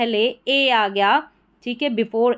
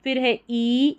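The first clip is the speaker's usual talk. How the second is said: normal talking voice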